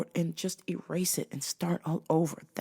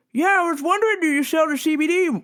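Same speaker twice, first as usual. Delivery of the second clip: much higher, louder mock caller; squeaky clueless caller; squeaky mock customer; 12 semitones higher, 7 dB louder, faster than usual. Caller voiced in retelling.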